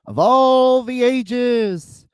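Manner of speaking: slow, booming ring announcer call; grand entrance announcement. Acted. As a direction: stretch every word out like an arena announcer. Opens his show like a ring announcer.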